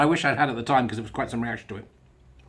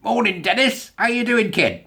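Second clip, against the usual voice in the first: calling out a greeting; 9 semitones higher, 3 dB louder, slower than usual. Pretend. Mock greeting after requested silence.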